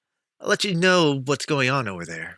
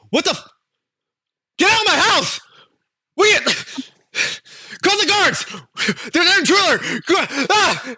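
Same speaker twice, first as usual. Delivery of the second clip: angry householders. Acted. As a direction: shout at the intruder. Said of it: "you hear a bunch of people go".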